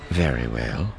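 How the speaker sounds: low, calm and measured; calm Thrawn voice. A narrator's reading. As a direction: speak low and even, unhurried.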